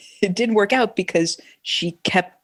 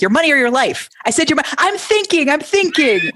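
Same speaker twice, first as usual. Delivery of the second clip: stingy comic voice; 8 semitones higher, 4 dB louder than usual. Retold or imitated. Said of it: "he's like".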